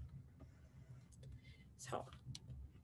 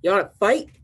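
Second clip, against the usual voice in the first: louder storybook challenge, rising at the end; grouchy ladybug; 3 semitones higher, 9 dB louder than usual. Acted. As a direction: pick a fight as a grumpy bug. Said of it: "grouchy ladybug".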